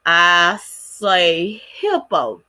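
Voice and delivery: very slow, drawn-out "hippo"; syllable demonstration. Shown as a demonstration. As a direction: stretch out "hippo" so both syllables show. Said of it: "two syllables for hippo".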